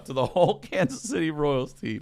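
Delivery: normal talking voice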